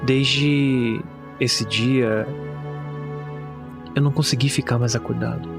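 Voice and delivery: grave, pausada horror narration; narração de conto de terror. Acted. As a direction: narrate a bleak horror tale, measured, with long pauses. (narração sombria de conto de terror)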